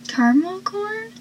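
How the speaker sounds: high, soft rising question; puzzled question. Retold or imitated. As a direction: quote it as a baffled, tentative guess. Acting out a puzzled mistaken guess.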